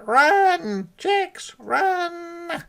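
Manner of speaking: very high hen crying warning; alarmed hen. A narrator's reading. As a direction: warn the chicks of the hawk. Guinea hen warns chicks.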